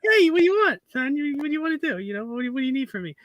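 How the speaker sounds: high lisping Tyson-style dad impression; Mike Tyson sideways. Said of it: "he sounds like Mike Tyson, except sideways".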